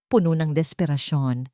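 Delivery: usual speaking voice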